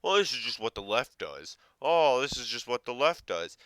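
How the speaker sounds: loud parroting of a cliché. Retold or imitated. Commentator mocking a pundit's stock line, loud parroting. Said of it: "you're going with the, the, the stereotypical".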